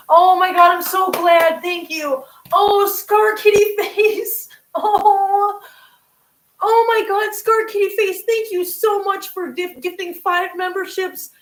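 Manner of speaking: high, loud, gushing thanks; gasp, then squeal your delighted thanks; thrilled surprise. Real, not acted. Streamer thanks viewer for gifting memberships, thrilled.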